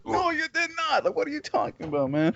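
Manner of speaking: mock indignation.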